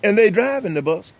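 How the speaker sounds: high, heated sermon-style delivery; fired-up preaching. Real, not acted. Talk show host preaching to viewers, fired up. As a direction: preach it with rising fire.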